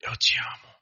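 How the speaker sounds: whispered, intimate declaration; hushed sweetheart murmur without voice; lovers' whisper. Acted. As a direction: whisper it close, for one person only. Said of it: "Casais que se amam são assim".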